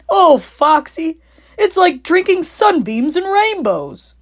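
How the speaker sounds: high, slow, swooning delight; rapturous. Acted. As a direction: Badger lost in bliss. Badger rapturous.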